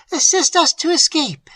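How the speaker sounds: high, loud command; urgent mistress. A narrator's reading. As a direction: urge the maid to help. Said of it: "said her mistress".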